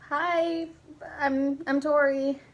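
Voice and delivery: high, loud, drawn-out introduction; acted awkward hello, slow and high; nervous retold greeting. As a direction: pitch it up and stumble into the name. Retold nervous first introduction to boy.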